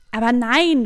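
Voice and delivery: lauter empörter Ausruf; entrüstet rufend. Acted. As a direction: ruf es empört heraus. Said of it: "ruft ihr entrüstet". (entrüstet laut)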